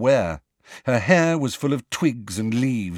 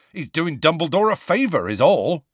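scathing; 7 semitones higher, 4 dB louder than usual. A narrator's reading. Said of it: "said Hagrid scathingly".

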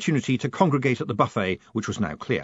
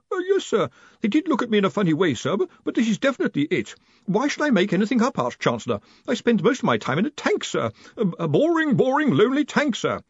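high, stammering, apologetic underling; flustered young wizard; 10 semitones higher than usual. Acted. Young wizard defending himself to the Arch-Chancellor, flustered. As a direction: explain yourself nervously in a high voice.

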